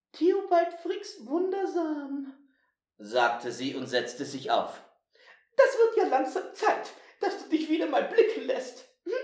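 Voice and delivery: very high, scolding granny mouse; bossy old mouse. Acted. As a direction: tease and scold like a brisk old lady mouse. Plays old Frau Blühsüß.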